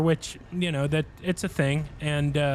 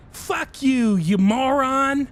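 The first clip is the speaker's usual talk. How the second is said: high, louder, slow mock insult; hammed-up quote of a rude friend; imitating Clint's insult; 9 semitones higher, 5 dB louder, much slower than usual. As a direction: spit out the insult with glee. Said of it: "Clint says".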